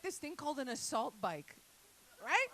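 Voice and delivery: ordinary delivery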